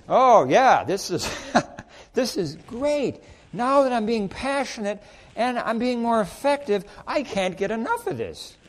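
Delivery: hammed-up joyful convert; excited believer. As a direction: gush with new enthusiasm.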